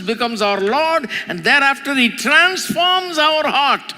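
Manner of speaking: high, loud, urgent pulpit delivery; impassioned sermon at a sustained high pitch; full-force preaching. Acted. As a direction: press each repeated point harder from the pulpit.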